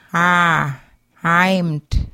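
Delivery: "heimt" with a short a squeezed in the throat; pharyngeal a. Shown as a demonstration. Teacher modelling the pharyngeal for learners, throaty a. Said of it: "like a short A deep in the back of the throat".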